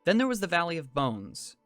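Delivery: ordinary delivery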